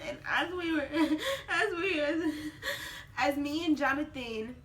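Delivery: choked laugh through tears; crying. Real, not acted. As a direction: voice breaking. Crying retelling.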